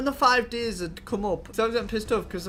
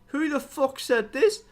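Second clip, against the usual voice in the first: outraged disbelief; 6 semitones higher, 4 dB louder, slower than usual. Retold or imitated. Outraged reaction to accusation.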